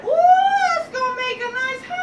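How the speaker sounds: high-pitched, thrilled piglet with stretched-out words; excited little pig. Acted. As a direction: squeal with delight at a great find. First pig delights in straw.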